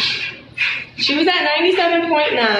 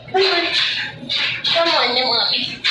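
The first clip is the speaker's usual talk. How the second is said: higher pet-talk call; coaxing the dog; 4 semitones higher than usual. Real, not acted. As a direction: gently urge the dog to come.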